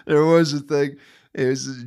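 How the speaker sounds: loud, laughing line. Real, not acted. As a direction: push through laughter. Laughing after pause.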